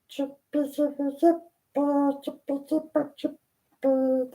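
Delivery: tiny high squeaky chirps; high chirping. Acted. Reader imitating the squeaking chirp heard in the dark. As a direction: chirp like a small creature, high and light. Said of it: "she heard the chirping again".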